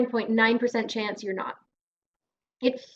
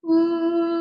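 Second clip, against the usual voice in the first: higher sliding ooh like a siren; 7 semitones higher, 3 dB louder than usual. Shown as a demonstration. Therapist demos a siren ooh for the class. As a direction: glide up and down like a siren. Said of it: "start it at a high".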